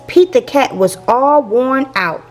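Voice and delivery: ordinary delivery, nothing marked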